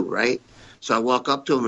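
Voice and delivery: ordinary delivery, nothing marked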